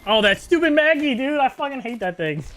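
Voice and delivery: loud, high gamer complaint; exasperated outburst. Real, not acted. Player cursing the character that beat them, high exasperated rant. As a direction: start at a yell, then ease off. Killed by hated game ability.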